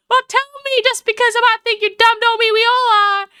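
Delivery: high, indignant Southern drawl; exaggerated Southern drawl. Proud Southerner rebukes Yanks for thinking her dumb, Southern drawl. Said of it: "I've given her the one with the drawl".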